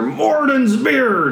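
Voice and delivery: high, drawn-out distant cry; dwarven battle oath. Acted. Dwarves heard fighting overhead, high drawn-out cry. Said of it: "every once in a while you hear".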